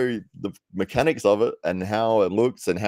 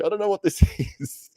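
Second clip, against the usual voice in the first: laughing; 13 semitones higher, faster than usual. Retold or imitated. Retelling confusion over lump.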